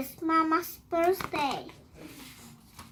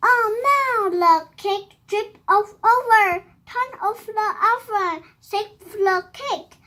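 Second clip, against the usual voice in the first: raised, louder storybook alarm; acted alarm; 4 semitones higher, 6 dB louder than usual. Monkeys rushing to save the cake, acted alarm. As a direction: call out an emergency, starting high and loud.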